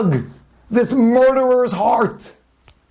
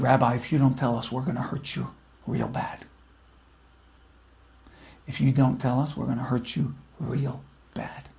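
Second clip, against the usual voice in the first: low, soft, cold threat; quiet menace; 10 semitones lower, 9 dB quieter than usual. Acted. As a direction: threaten him softly, close in. Thug threatens the rabbi.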